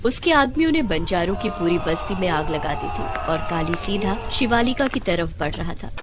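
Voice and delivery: ordinary delivery, nothing marked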